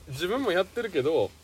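ordinary talk

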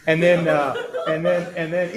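real chuckle through the words; laughing. Real, not acted. A man recalling his joke to a friend, laughing awkwardly. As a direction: laughs as he stumbles over and then. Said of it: "I did it with a wink and a smile".

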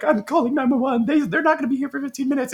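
high-pitched, rushed mock panic; hammed-up, high panicky retort; freaking out. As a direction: imitate a frantic wife insisting on help. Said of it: "Adelaide is freaking the fuck out".

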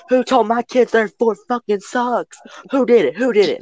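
very high mock yell; shrieky pitch without real volume, mock fury; irate mother impression. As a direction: imitate an outraged mom demanding the culprit. Retold furious mother confronting kids.

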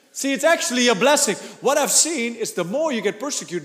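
ordinary talk, nothing marked

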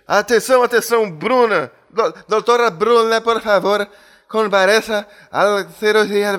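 loud, high mock Spanish announcer; Spanish accent. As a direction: page the doctor in hammy Spanish accent. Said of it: "Olha o espanhol do [name]". (sotaque espanhol de brincadeira)

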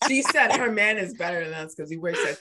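teasing friend laughing-whining about the boast; laughing mock complaint. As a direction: whine it while laughing.

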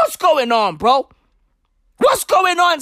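usual speaking voice

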